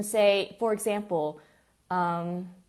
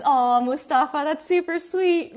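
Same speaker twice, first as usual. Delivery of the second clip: gushing; 7 semitones higher, 6 dB louder than usual. Real, not acted. Gushing over sweet example.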